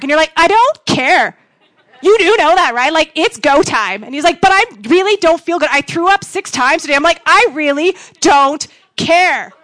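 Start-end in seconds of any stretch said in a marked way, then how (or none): 0.4-4.0 louder, higher, stretched-out dismissal; mock insistent demand. Acted quarrel, brushing off husband's excuse. 4.4-6.9 whiny protest. Retold or imitated. Acted sick husband's protest. 7.3-9.5 loud, slow, each word stretched out; emphatic mock dismissal. Acted quarrel, refusing husband's excuse.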